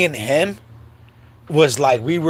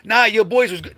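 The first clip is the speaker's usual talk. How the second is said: neighbors calling out; 10 semitones higher than usual. Retold or imitated. Block dudes pleading with the angry mom to chill. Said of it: "So they're like".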